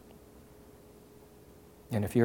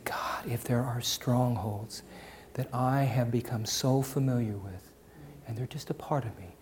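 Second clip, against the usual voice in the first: whispered prayer; 6 dB quieter than usual. Acted. Quoted prayer.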